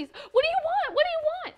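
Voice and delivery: high, loud panicked question; scared boy shouting up at armed men; frightened boy. Acted. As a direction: cry out in fear at the strangers.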